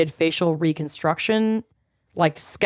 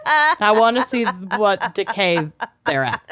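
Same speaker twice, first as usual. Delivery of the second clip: laughing; 4 semitones higher than usual. Real, not acted. Joking, laughing along.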